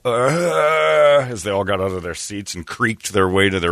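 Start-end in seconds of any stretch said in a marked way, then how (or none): 0.1-1.3 long, higher-pitched straining "uh"; imitated old-age groan. Old people getting out of their seats, imitated groan. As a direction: groan as if heaving out of a seat. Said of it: "and then that audible".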